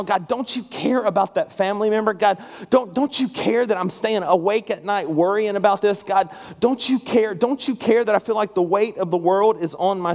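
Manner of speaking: high, insistent pleading with God; pained plea. Acted. People asking God in the midst of pain, acted anguished plea. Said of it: "asking God that question in the midst of pain".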